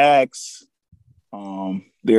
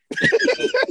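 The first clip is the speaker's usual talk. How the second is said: high laugh. Real, not acted. Laughing at Randy Quaid.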